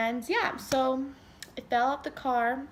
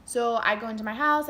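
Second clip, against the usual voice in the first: ordinary talk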